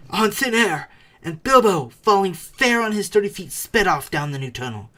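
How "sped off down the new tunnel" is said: usual speaking voice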